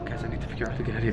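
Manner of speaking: low, soft, quick murmur; hushed aside. Acted. Telling viewers about needing a way out, hushed quick aside. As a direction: confide it fast under your breath. Confides in viewers after shouting.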